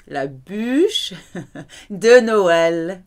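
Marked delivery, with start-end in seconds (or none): 0.1-1.2 la bûche with a rounded French u, not bouche. Shown as a demonstration. Teacher contrasts bûche with bouche, careful u. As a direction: lips rounded tight for the u in la bûche. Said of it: "but B U U".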